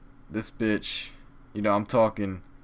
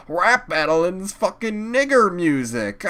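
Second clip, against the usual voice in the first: mocking laugh; 10 semitones higher, 7 dB louder than usual. Mocking the friends' disbelief.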